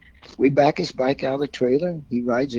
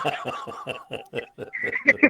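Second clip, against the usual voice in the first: a burst of real high laughter; 12 semitones higher, 6 dB quieter than usual. Real, not acted. Telling friends about being his bodyguard, real laughter. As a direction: let the laugh break out.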